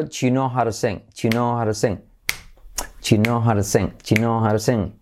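"Do you" run together as "chu" with the whole question linked; everything linked, "Do you" becomes chu; contracted. Shown as a demonstration. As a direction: say "Do you know how to sing?" as chu-no-haro-sing. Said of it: "Y he contraído".

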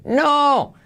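"no" agudo y alargado; no rotundo exagerado. Shown as a demonstration. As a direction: niega teatralmente, estirando la vocal. Rechaza la forma incorrecta, exagerando. (negación cómica y enfática)

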